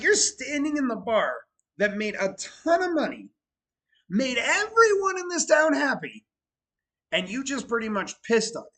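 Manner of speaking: indignant outburst. Retold or imitated. Telling the grandson off about the owners, indignant and loud. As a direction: let the outrage build, then settle.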